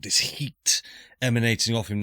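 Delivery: normal talking voice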